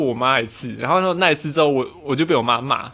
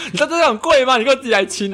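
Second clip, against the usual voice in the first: high, laughing mock scolding; imitating angry friend; 9 semitones higher than usual. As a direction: play the annoyed classmate, half laughing. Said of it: "他就很生气".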